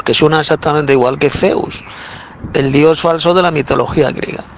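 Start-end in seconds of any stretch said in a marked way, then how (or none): none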